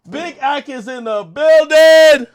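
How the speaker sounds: high, slow, drawn-out holler; hype announcement. Real, not acted. Streamer opening his stream, drawn-out hype holler. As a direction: stretch it out like an arena entrance.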